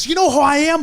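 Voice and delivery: mock spoiled driver demanding respect; indignant entitled girl. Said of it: "she goes".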